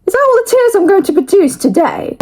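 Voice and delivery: high incredulous self-mockery; sarcastic surprised whine; mock disbelief. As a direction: pretend shock at few tears. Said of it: "I was like".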